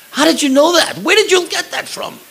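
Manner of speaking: high, loud retold amazement; astonished. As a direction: imitate someone amazed, firing questions up high. Acted astonished question to Maria.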